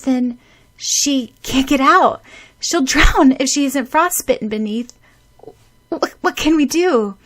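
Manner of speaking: high, loud, fearful pleading; panicked worry. Acted. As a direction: panic over her drowning. Friend panics.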